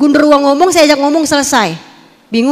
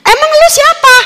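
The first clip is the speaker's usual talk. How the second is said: much higher, demanding; who do you think you are; annoyed demand to the voice; annoyed challenge; 12 semitones higher, 4 dB louder than usual. Retold or imitated. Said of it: "Terus gua bilang".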